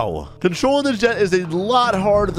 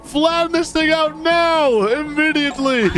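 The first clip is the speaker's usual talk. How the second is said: high-pitched panicked order, acted; alarmed instructor; 11 semitones higher, slower than usual. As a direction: playfully act an alarmed flight instructor.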